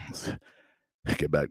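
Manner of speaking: slow breathy drag on the mic; heavy breathing. Real, not acted. Comedian pastor feeling himself, breathing hard on the mic. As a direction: pant into the mic. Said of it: "breathing hard on the mic".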